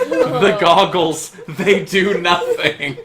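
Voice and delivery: slow, drawn-out mock anguish; hammed-up joke quote. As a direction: lament it slowly, word by word, amused. Hammed-up joke quote while laughing.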